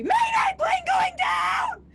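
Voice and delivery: shrieked high Mayday; screaming panic. Acted. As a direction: scream it like the plane is falling. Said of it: "or as we call it in the industry".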